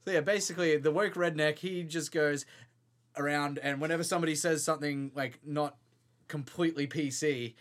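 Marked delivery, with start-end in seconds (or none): none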